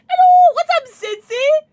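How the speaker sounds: high, loud squealed hello; high-pitched loud hello; excited greeting. Real, not acted. Excitedly greeting someone arriving in stream.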